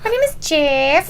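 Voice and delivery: awkward fake Spanish accent, lifting at the end; stiff, constipated comic Spanish accent; mock Spanish accent. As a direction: put on a bad Spanish accent. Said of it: "You got your accent down".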